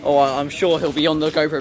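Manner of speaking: ordinary talk, nothing marked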